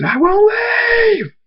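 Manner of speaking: high scream stretched long on the last word. A narrator's reading. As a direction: scream it in drawn-out defiance. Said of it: "Thunderstorm screams".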